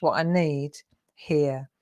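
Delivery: normal talking voice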